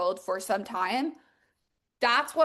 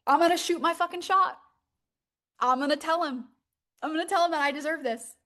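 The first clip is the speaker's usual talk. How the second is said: bold self-talk; 5 semitones higher, faster than usual. Retold or imitated. Client voiced deciding to act.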